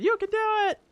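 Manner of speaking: high-pitched imitated cheer; mock shout of encouragement; retold shout. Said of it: "Someone shouted".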